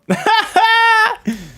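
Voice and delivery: loud, high burst of laughter; laughing. Real, not acted. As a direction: crack up in a high cackle. Cracks up after joking.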